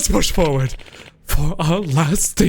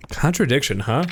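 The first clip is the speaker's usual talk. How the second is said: ordinary talk, nothing marked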